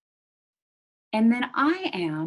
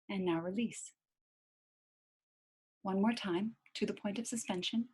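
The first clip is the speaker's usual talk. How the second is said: guided breathing. Shown as a demonstration. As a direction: lead the breath calmly, almost hushed. Guided breathing, soft and fading.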